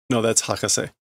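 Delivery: ordinary talk, nothing marked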